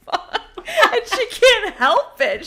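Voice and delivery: high, laughing explanation. Real, not acted. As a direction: talk through the giggles.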